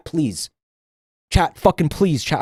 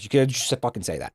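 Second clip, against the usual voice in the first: muttered repeat; 5 semitones lower, 5 dB quieter, faster than usual. Real, not acted. Streamer repeating advice to chat, quiet muttered aside. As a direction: lower and softer, trailing off.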